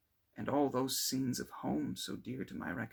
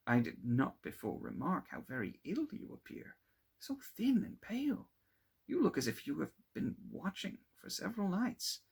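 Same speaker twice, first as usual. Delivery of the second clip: put-on higher, anxious young man; concerned friend; 8 semitones higher than usual. Clerval telling Victor he looks ill, worried. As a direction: voice rising in worry at his pallor.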